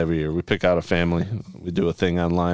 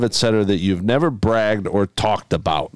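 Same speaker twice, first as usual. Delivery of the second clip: ordinary talk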